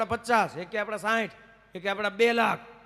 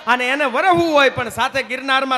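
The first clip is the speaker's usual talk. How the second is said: louder, rising poetic declamation; louder, higher stage declamation about rain and monsoon songs; stage oration; 3 semitones higher, 7 dB louder than usual. Acted. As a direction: proclaim it to the crowd with fervour.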